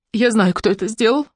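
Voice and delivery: understated weepy admission; sobbing. A narrator's reading. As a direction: say it with a suppressed sob. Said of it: "всхлипнула Матильда".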